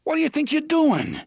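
high, loud demand; shocked shout. Acted. Eddie confronting Roland over the gun belt, loud and high. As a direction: fling it at him in a loud high burst.

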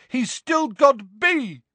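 high, drawn-out shout; high-pitched call from afar. A narrator's reading. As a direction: yell it slowly down from above. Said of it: "shouted a voice from above them".

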